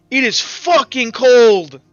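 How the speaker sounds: ordinary delivery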